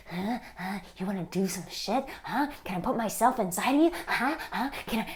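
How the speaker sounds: pushy creep. Retold or imitated. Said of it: "that kind of energy of like".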